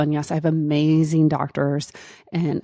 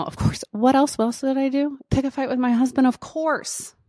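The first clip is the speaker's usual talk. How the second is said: mock-innocent, pitched way up; sarcastic self-mockery, voice jumping higher; 9 semitones higher than usual. Real, not acted. Sarcastic self-mockery about picking fights.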